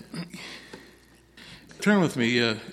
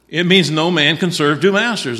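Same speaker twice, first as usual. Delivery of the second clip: emphatic preaching; 4 semitones higher, 6 dB louder than usual. Acted. Preacher pressing the point.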